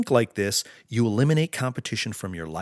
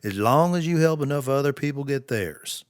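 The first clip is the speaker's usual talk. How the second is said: a light Southern US drawl, faster and higher; 3 semitones higher than usual. Retold or imitated. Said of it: "he said it in his southern drawl".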